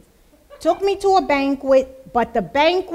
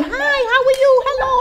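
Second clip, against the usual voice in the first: high, bright sing-song hello; cheerful greeting; 11 semitones higher, 4 dB louder, much faster than usual. Retold or imitated. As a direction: greet the staff with a big friendly lift. Greeting store staff cheerfully.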